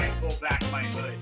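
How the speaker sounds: ordinary delivery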